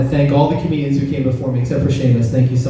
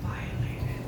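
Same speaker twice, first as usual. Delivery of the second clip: whispered again; 17 dB quieter than usual. Shown as a demonstration. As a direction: whisper the word violated, softer. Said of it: "In case you guys didn't hear that".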